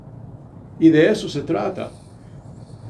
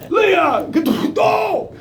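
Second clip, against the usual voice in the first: shouting at daughter; 13 semitones higher, slower than usual. Retold or imitated. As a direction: bellow her name across the house. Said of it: "como si hablara con Lía".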